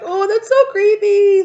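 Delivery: high, loud and slow; seeing a creepy costume at a party; creeped-out squeal. Pretend.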